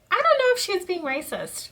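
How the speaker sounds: high, mocking unsure voice; mock doubtful castmate. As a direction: parody a castmate's hedging. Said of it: "was like".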